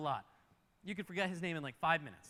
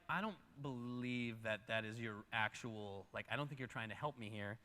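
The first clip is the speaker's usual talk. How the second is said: low, quiet, doubtful voice; skeptical reply; 7 semitones lower, 7 dB quieter than usual. Acted. Nehemiah answering the enemy's invitation, low and skeptical.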